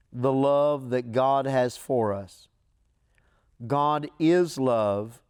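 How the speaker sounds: slow, loud solemn scripture reading. Acted. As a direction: read the verse slowly, weighing each word. Reads scripture slowly.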